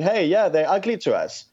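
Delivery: ordinary talk, nothing marked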